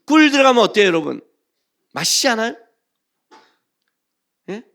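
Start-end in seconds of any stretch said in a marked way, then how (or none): none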